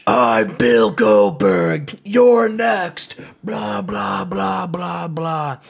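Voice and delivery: slow, loud, mocking caveman Goldberg voice; Goldberg impression. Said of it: "I'll do my best Goldberg impression".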